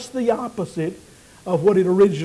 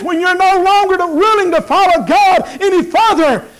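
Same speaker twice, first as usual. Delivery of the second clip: rising, louder, higher preacher's build; preaching at full force; 8 semitones higher, 5 dB louder than usual. Acted. Pastor urging the congregation toward abundant life, in a rising preaching voice.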